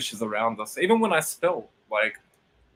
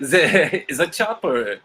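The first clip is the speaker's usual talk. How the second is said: high, loud and slow through laughter; laughing film quote; 5 semitones higher, 6 dB louder, slower than usual. Acted. Joking with a friend over a film quote, laughing loudly. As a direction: crack up while quoting the line.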